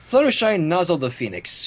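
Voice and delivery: ordinary talk, nothing marked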